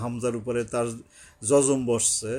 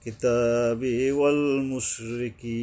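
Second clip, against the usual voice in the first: long final ee in মুশরিকি; slow elongated recitation; much slower than usual. Shown as a demonstration. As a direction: recite slowly, stretching the end of মুশরিকি. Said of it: "ইয়ার উপরে". (মুশরিকি শেষে টান)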